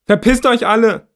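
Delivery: high, loud furious scream; loud high desperate yell. A narrator's reading. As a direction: scream at everyone to get out. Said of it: "Dann schreie ich noch einmal".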